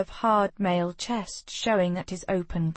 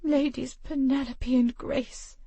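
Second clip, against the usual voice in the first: plain reading voice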